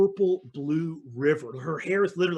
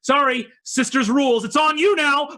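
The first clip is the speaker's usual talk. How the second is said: high, quick singsong send-off; raised-pitch sorry-not-sorry farewell; queens' hasty apology; 10 semitones higher, 4 dB louder than usual. Acted. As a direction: call it out high and breezy. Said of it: "all three other queens look at you, their champions, and go".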